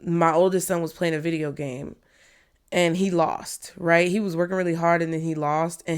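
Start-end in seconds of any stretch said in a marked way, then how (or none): none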